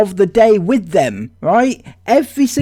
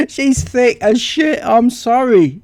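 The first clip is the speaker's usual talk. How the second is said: ordinary talk, nothing marked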